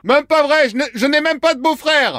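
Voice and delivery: high mock denial; protesting patient. Prankster plays patient denying it.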